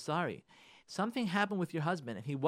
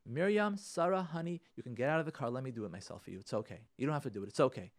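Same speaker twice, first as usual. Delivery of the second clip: softer, lower, soothing husband; gentle reassurance; 4 semitones lower, 6 dB quieter than usual. Acted. Husband offering to move the car for his wife, acted gentle reassurance. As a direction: let her off the hook tenderly.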